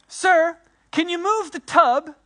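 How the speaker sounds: put-on high, polite small boy asking; little boy voice. Neighbor's boy asks him to move the tub, high childlike voice. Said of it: "And he goes".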